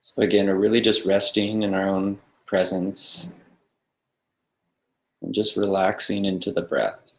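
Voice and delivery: soft, calm meditation guide with long pauses; guided meditation. Real, not acted. Meditation teacher settling a group into the breath, calm guiding voice. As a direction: ease them into stillness, leaving silence between phrases.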